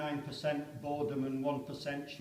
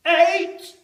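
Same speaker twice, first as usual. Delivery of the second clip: much higher, loud distant yell; far-off officer's shout; shouted count; 15 dB louder than usual. Acted. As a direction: bellow the number down the line. Countdown called in trench.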